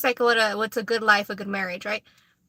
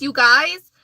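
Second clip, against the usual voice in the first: ordinary talk, nothing marked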